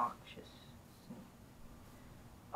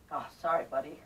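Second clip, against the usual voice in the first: ordinary talk, nothing marked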